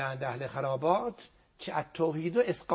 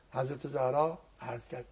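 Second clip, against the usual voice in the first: usual speaking voice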